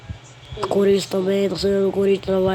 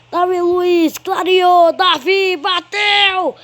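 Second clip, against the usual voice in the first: excited commentary; 8 semitones higher, 5 dB louder, much slower than usual. Acted. Commentator calls a chance, keeper saves.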